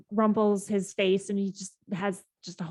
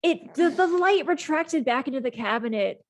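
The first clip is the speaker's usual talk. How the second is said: upset; 5 semitones higher, 3 dB louder than usual. Acted. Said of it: "He's so upset. He's like".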